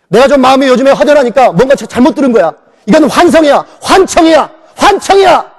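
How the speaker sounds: denial building to loud, high insistence; rising panic. Retold or imitated. Frantic denial in dream. (꿈속에서 점점 격해지는 부정)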